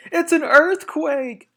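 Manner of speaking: very high, loud, slow panicked shout; alarmed cry. Acted. Missus Fox crying out to her family, loud and panicked. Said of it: "cried Missus Fox".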